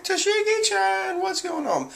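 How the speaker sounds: squealing falsetto cry of worry; mock alarm. G-5 men calling to fallen Tashigi, mock alarm. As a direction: call out in an exaggerated very high voice.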